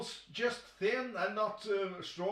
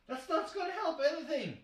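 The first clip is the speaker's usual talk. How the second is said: exasperated outburst; 9 semitones higher, 3 dB louder, faster than usual. Real, not acted. Exasperated rant at fans.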